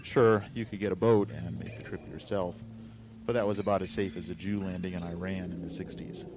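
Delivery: ordinary delivery, nothing marked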